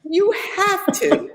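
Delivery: high, slow comic voice; same silly drawn-out line; hammed-up insistence again. Repeating the joke to the laughing guest, high and slow. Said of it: "Anything for a laugh".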